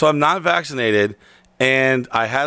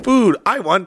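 high speak-and-say toy; talking toy voice; 6 semitones higher than usual. Acted. Translation board speaking for a hungry gringo, high toy voice. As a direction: pitch it up like a learning toy. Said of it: "like a speak and say".

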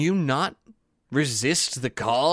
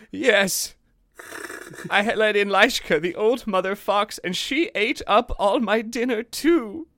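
put-on higher, small boy's confession; child confessing; 7 semitones higher than usual. A narrator's reading. Budulinik confessing to Granny, small guilty voice. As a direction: own up like a guilty little kid. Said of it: "Granny said".

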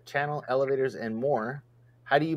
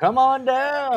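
game show announcer; 11 semitones higher, 4 dB louder, slower than usual. Pretend. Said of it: "Price is Right catchphrase".

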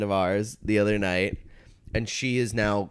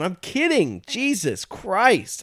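higher, louder exasperated protest, slowing on the curse; 8 semitones higher, 4 dB louder, slower than usual. Retold or imitated. Comic defending his joke to a friend, exasperated. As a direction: throw up your hands, drawn-out Jesus Christ. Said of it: "I just went".

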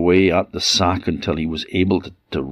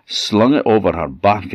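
ordinary delivery, nothing marked

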